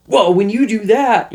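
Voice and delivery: high, needling mock nag; nagging jab. To an upset person, needling and nagging in a high voice. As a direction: pick at them like a scold. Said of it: "and just start picking on 'em".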